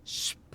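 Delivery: "sp" as a hushed "shp" with no voice; whispered sp. Shown as a demonstration. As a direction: breathe out "sp" as "shp". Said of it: "por isso sp".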